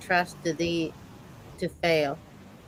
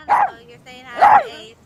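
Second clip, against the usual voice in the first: high squeal to the dog; 9 dB louder than usual. Real, not acted. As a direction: squeal at the puppy. Talking to the dog.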